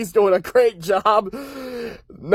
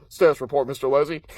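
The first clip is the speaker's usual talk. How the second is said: Darth Vader voice; 6 semitones lower, faster than usual. Retold or imitated. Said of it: "the Darth Vader parody".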